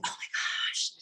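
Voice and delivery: retold breathy, near-whispered exasperation; exasperated hush. Planner retelling her reaction after a hard sales call, exasperated hush. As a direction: let it out on a spent breath, barely voiced.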